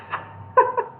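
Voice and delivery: breaking up at one's own silly voice; real laugh. Real, not acted. Reader amused at choosing Mister Bean for Gandalf, laughing. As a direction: laugh at your own casting.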